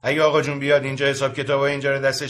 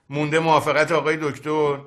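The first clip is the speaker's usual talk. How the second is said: normal talking voice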